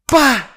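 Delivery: explosive high "pa" for a slap; sudden loud smack noise; loud imitated slap. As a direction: punch out the sound of a smack.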